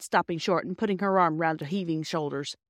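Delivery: usual speaking voice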